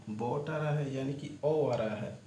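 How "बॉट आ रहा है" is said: ordinary talk, nothing marked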